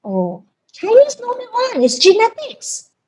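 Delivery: high, slow, dawning mock wonder; dumbstruck realisation. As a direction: act as if the penny just dropped. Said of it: "I'm like".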